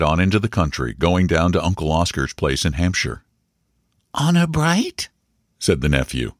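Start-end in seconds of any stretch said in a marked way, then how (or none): 4.1-5.1 put-on high, questioning child's voice; small boy checking a promise, pitched high; high rising question from a child; earnest young boy. A narrator's reading.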